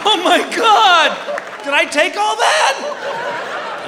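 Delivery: high, slow, shocked squeal; mock horror. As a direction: wail in comic disbelief. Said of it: "I'd be like".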